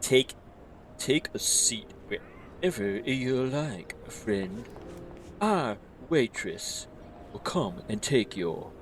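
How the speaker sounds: lightly voiced snooty elf host. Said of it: "Snooty elf voice time".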